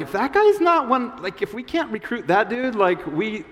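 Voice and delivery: can't believe it; baffled. Acted. Disciples fret they lost their best recruit, high dismay. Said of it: "They're like".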